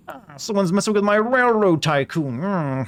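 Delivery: put-on high, sly old-timey villain; mustache villain. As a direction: scheme like a railroad tycoon villain. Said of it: "cartoon villain voice".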